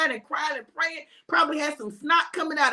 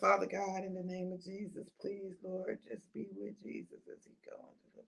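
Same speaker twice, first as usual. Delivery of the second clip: fading sleepy prayer; 6 semitones lower, 16 dB quieter than usual. Acted. Disciple dozing off mid-prayer.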